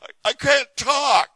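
slow, high, hammed-up wail; mock distress. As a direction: imitate a man loudly despairing. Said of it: "he was almost in tears".

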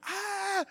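high-pitched mock scream of pain; imitated scream. Worker retelling his crushed hand, imitated scream. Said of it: "I'm screaming".

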